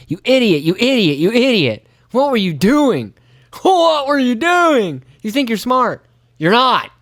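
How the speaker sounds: high, wailing you idiot; self-berating. Retold or imitated. Angler scolding himself after the mess, high mock wail. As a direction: scold yourself with mock anguish.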